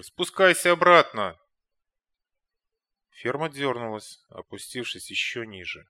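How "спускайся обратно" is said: loud high command; shouting orders over battle. A narrator's reading. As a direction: yell the order over the din. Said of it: "заорал командир". (командир кричит приказ)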